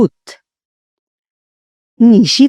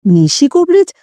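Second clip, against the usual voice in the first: ordinary delivery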